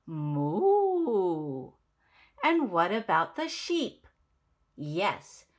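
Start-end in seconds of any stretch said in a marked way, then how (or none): 0.1-1.7 low drawn-out moo like a cow. Shown as a demonstration. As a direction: moo it like a friendly cow for kids. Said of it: "The cow says".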